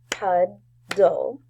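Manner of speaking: "Puddle" as pud, pause, dle, both beats loud; split into syllables. Shown as a demonstration. Teacher saying syllables for the class, "Puddle" split as pud... dle. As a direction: break "Puddle" into two clear beats. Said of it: "say the syllables in puddle".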